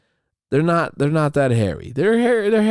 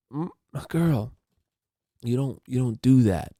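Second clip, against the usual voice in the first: ordinary talk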